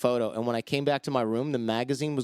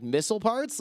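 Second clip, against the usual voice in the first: light joking question, pitched up; jokingly; 9 semitones higher than usual. Retold or imitated. Teller jokingly quizzing the arms dealer, pitched up. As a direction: tease him with the guess. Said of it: "Like, jokingly".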